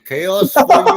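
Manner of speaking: loud, high wrestler taunt; Iron Sheik. Acted. Wrestler calling out his target, hammed-up Iron Sheik voice. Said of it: "I am Iron Sheik".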